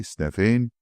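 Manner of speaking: ordinary delivery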